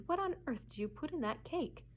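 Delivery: höher, erschrocken ausgerufen; exclamation. Acted. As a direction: cry out in shock at the taste. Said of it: "she exclaimed".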